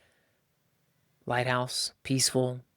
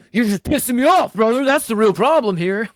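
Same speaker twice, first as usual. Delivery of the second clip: high, loud hammed-up irritated wife; mock angry wife; 9 semitones higher, 8 dB louder than usual. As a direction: play the wife snapping at her husband, overdone. Voicing hidden anger.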